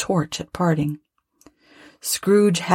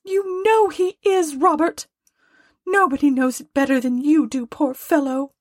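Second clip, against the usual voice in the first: very high, scolding wife; high, exasperated wifely tirade; indignant Mrs Cratchit; 10 semitones higher than usual. Acted. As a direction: rail about him in a high-pitched voice. Voices Mrs Cratchit railing at Scrooge.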